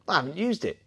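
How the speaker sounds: high, cocky mock reply. Caravan owner answering the challenge, mock cocky. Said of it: "turned round all cocky and went".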